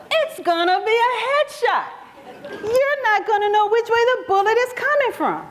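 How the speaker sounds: high, pressing disbelief at the plan; incredulous protest. Retold or imitated. To the FBI agent, refusing to be bait, alarmed and high. Mother objects to the decoy plan.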